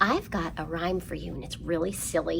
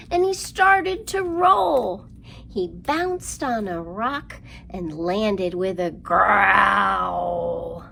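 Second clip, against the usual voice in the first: slow lively rhyme, louder on the roll and growl; rhyme for kids; 5 dB louder, slower than usual. Acted. Librarian reciting counting rhyme to children, lively. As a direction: act out the lions tumbling.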